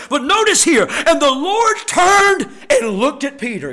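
loud, ringing scripture reading with drawn-out key words; impassioned pulpit voice; full-force preaching. Real, not acted. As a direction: preach the verses hard to the congregation. Bursts back into preaching.

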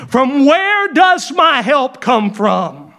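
slow, asking tone on does; rising question tone. Shown as a demonstration. Preacher reads verse as a question. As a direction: ask it slowly as a real question. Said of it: "It's a question".

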